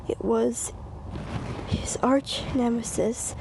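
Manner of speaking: ordinary delivery, nothing marked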